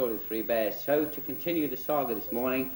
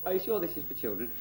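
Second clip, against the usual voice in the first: normal talking voice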